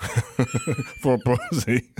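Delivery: slow slurred giant with a laugh; slow giant mumble. Retold or imitated. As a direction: drag the words like a giant. Andre voice, crude joke.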